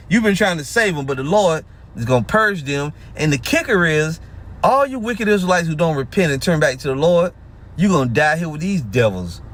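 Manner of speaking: usual speaking voice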